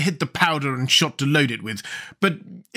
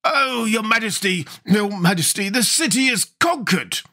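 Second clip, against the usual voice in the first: high, gasping report; out of breath; 8 semitones higher than usual. A narrator's reading. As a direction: gasp the news out, winded. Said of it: "gasped the royal army, who was all out of breath".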